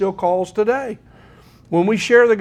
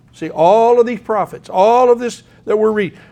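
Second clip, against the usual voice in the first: fervent preaching; 4 semitones higher, 6 dB louder than usual. Real, not acted. Emphatic preaching build.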